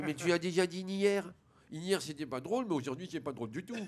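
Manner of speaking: lisped complaint, s as sh; mock-indignant little boy with a hushing lisp; speech defect. Little boy tired of the repeated prank, lisped complaint. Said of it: "il parle comme ça".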